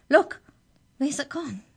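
high, loud start on a cry; startled and high-pitched; alarmed cry. A narrator's reading. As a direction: cry out in astonishment. Girl alarmed that house has vanished.

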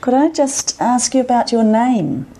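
ordinary talk

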